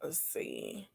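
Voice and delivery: very low, hushed mutter; muttering to self. Real, not acted. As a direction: murmur it to yourself, barely audible. Muttering while reading chat.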